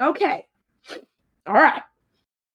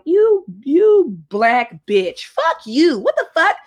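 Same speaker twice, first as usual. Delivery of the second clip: loud cursing tirade, climbing higher; retold furious outburst; 6 semitones higher, 4 dB louder than usual. Telling off the man who messaged again, furious. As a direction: start slow and loud, then go off. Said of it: "I just went off".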